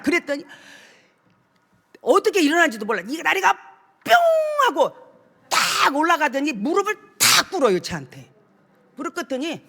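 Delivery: normal talking voice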